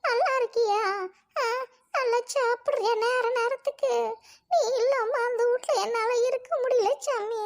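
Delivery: high, tearful grandmother's welcome. Acted. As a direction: greet a missed loved one through tears. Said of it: "அழுவாத பாட்டி". (அழுகையோடு)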